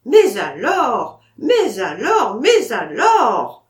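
loud, slow, spluttering repetition; exasperated outcry. Acted. Vatrin to the narrator, crying out in exasperation, loud and slow. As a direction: sputter in helpless exasperation. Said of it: "s'écria Vatrin exaspéré".

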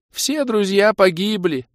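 high, slow, wailing lament; sad. A narrator's reading. Tearful elf tells of lost friends, sorrowful. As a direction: lament it loudly and slowly, up high. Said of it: "с грустью поведал мне Орбит".

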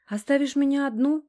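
high, guilt-tripping little plea; hurt pout. A narrator's reading. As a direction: pout it to work on his conscience. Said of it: "изобразила обиженную мордашку, давя на мою совесть".

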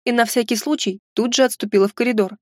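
usual speaking voice

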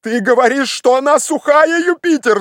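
very high, agitated shout; alarmed, high-pitched exclamation; breaking voice cry. A narrator's reading. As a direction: cry out as your voice gives way. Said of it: "закричал Легран прерывающимся голосом".

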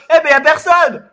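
laughing mockery. Mocking the callee, laughing.

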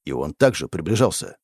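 usual speaking voice

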